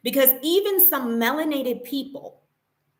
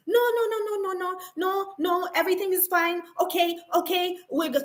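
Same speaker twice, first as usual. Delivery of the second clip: mock panicking manager; 6 semitones higher than usual. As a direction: imitate her rushing in to calm things down. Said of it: "comes rushing out".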